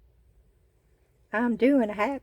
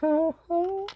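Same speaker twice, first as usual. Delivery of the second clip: delighted laugh; 10 semitones higher than usual. Real, not acted.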